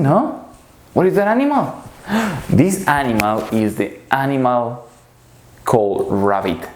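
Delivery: normal talking voice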